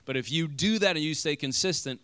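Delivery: ordinary delivery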